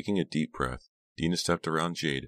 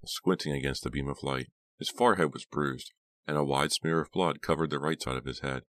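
ordinary delivery, nothing marked

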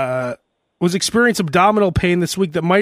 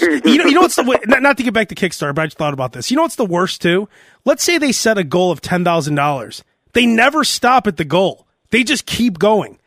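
normal talking voice

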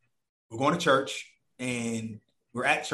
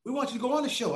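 high urgent interviewer voice; 11 semitones higher than usual. Retold or imitated. Interviewer voiced, stopping him.

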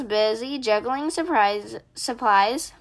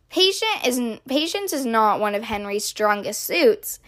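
usual speaking voice

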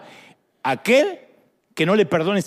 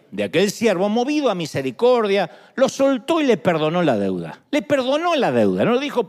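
ordinary delivery

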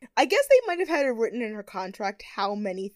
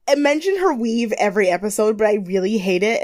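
normal talking voice

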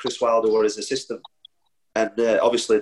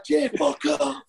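retorting at teammate; much slower than usual. Retold or imitated. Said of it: "I'd be like".

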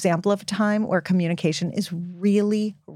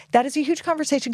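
fast, higher throwaway repeat; quick repeat; 6 semitones higher, faster than usual. Real, not acted. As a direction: rattle it off again, brighter.

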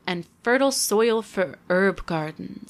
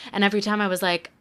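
ordinary delivery

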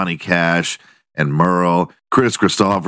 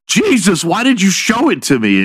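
high-pitched mock dismay; mock appalled; 11 semitones higher than usual. Man to woman after the reveal, mock appalled. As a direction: recoil in exaggerated, high-voiced complaint. Acted complaint in imagined exchange.

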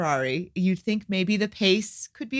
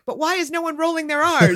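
high, loud baffled complaint; retold exasperation; 10 semitones higher, 5 dB louder than usual. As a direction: blurt it out like a nagging thought. Retells distracted exasperated thought during film.

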